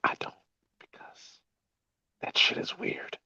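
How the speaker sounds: hushed, voiceless whisper. Pretend. Host teasing co-hosts by whispering that it's weird, whispered. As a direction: whisper it close to the mic. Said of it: "Why are you whispering in my fucking ear?".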